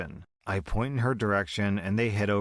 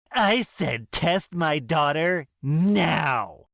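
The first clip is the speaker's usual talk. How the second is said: much louder, higher, very slow demand; furious mother; 7 semitones higher, 5 dB louder, much slower than usual. Acted. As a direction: cut her off in rage. Mother furious, cutting nurse off.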